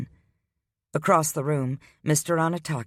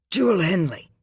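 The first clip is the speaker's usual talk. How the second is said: bitten out; 7 semitones higher, 4 dB louder than usual. A narrator's reading. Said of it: "he bit out".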